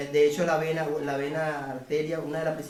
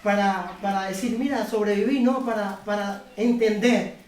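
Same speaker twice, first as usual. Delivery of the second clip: rising fervent preacher's call, louder at the end; building sermon climax, pressing and louder; preaching; 3 semitones higher, 4 dB louder than usual. Acted. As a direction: proclaim it to the congregation. Closing exhortation to the congregation.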